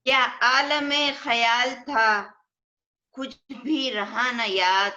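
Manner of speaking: poetry reading at a mushaira; ghazal recitation. Acted. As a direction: recite it as an Urdu couplet, lingering on the refrain.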